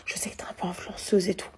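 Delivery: hushed, secretive voice; soft whispered imitation. Storyteller imitating the client's hushed whisper. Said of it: "elle parlait comme ça doucement".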